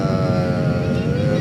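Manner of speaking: long low "uh" like a pilot on the intercom; drawn-out pilot uh. Shown as a demonstration. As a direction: stretch out a low hesitation sound. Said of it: "do it in a little bit of a lower tone than that".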